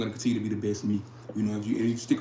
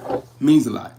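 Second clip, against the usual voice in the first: much louder, heartfelt "It means a lot"; emphatic repeat; 2 semitones higher, 13 dB louder than usual. Real, not acted. Streamer thanking a stranger's kind comment, loud and heartfelt. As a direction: burst out with it the third time.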